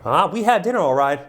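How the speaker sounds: put-on high, loud gushing Southern woman, Southern accent. Retelling the divorced woman's story, in a giddy Southern accent. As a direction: swoon in a Southern accent, breathless with delight. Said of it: "I need to work on my Southern accent".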